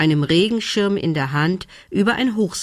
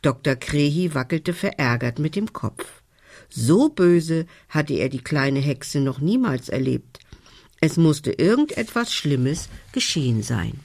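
usual speaking voice

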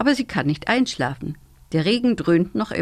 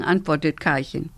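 usual speaking voice

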